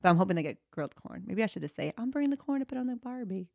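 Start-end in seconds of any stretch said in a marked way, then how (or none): 2.0-3.5 put-on a quiet, light Australian accent. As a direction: slip into an Australian lilt on Barbie. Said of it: "I don't know why I got Australian".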